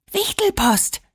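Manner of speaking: high, thrilled little exclamation; hushed excitement. A narrator's reading. Liliana discovers elf mail, in an excited hush. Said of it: "flüsterte Liliana".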